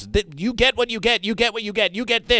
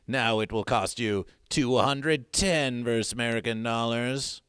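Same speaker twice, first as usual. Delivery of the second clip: much lower, softer menace; ominous; 7 semitones lower, 3 dB quieter than usual. Acted. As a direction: warn them darkly. Ominous low warning.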